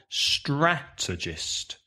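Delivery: strategist said slowly, STRA punched; slow model word, stress up front. Shown as a demonstration. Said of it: "stress the first syllable".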